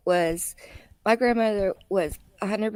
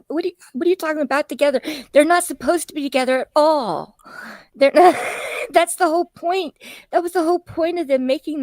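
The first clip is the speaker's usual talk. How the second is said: higher, louder, fast outrage; exasperated rant; 8 semitones higher, 3 dB louder than usual. Real, not acted. Arguing against her husband's church-and-state idea, loud and exasperated. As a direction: push back hard, fast and loud.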